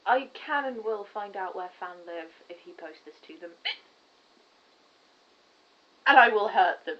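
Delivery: low, lightly mock villain threat; menacing low voice. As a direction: growl it out as a joke threat. Mock threat about posting video.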